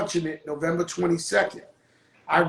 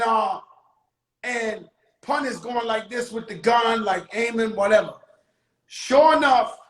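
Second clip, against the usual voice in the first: ordinary delivery, nothing marked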